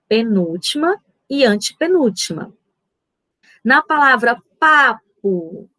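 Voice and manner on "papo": "papo" slowly, with a long first "pa"; stress on PA. Shown as a demonstration. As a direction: stretch the first syllable of "papo". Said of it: "o pá é a sílaba tônica".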